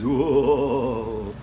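loud ghostly moan; spooky wail. Acted. Brother's spooky wail shown.

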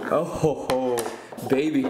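ordinary talk, nothing marked

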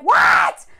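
loud, sudden shocked yell; shocked shout. Retold or imitated. Acting out a betrayed woman, loud shocked shout. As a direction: shout it in disbelief.

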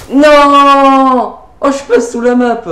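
loud, high dismayed yell; shouted no. Real, not acted.

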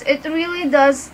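normal talking voice

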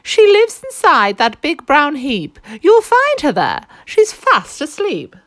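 a hen calling out, high and drawn. A narrator's reading. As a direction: chirp it out like an eager hen. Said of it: "chipped in the hen".

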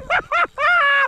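squealing high-pitched laugh; giddy high laugh. Real, not acted. Fisher cracks up at his catch, squealing laugh. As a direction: let a giddy shriek of laughter out. Giddy after twenty-first fish.

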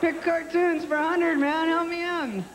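high, drawn-out mock panic; freaking out. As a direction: plead in a hammed-up frenzy. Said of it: "start to freak out".

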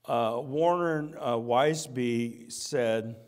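ordinary delivery

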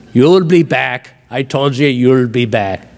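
put-on a light Scottish accent, rolled and clipped. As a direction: Groundskeeper Willie growl in a Scottish accent. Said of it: "did him with a Scottish accent".

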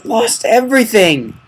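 high, loud and slow, wailing the loss; loud anguished lament; frustrated cry. Real, not acted. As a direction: let it burst out in despair. Discovers all items gone.

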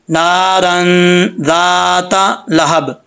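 slow, humming hold on the n of नारन; recitation with ghunna. Shown as a demonstration. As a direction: stretch नारन and ज़ाता, land on लहब. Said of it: "गुना होगा". (नारन में गुन्ना, ठहरकर तिलावत)

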